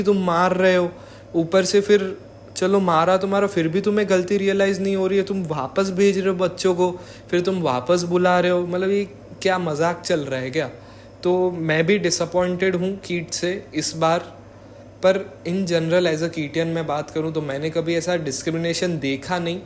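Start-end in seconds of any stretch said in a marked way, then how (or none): none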